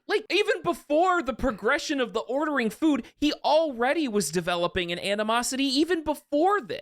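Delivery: keen agreement; excited. Real, not acted. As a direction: jump in excitedly. Excited point in discussion.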